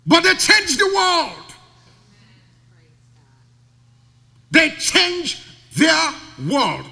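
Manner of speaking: high, loud, very slow proclamation; preacher's slow ringing climax; climactic preaching. Acted. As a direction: hold each word as a climax.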